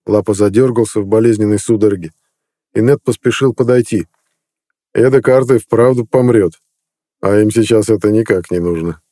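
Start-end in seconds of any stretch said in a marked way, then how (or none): none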